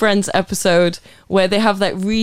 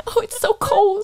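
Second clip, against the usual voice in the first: high laughing quote; 9 semitones higher, 3 dB quieter than usual. Retold or imitated. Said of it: "I just say".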